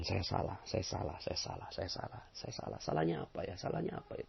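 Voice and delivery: very soft, low introspective murmur; hushed, low self-questioning; humble self-blame. Shown as a demonstration. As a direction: murmur it to yourself, almost voiceless. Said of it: "Jadi harusnya gimana dong?".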